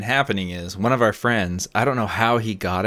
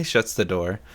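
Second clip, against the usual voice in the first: ordinary talk, nothing marked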